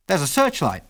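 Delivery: high cry of sudden discovery; excited cry. A narrator's reading. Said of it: "he cried".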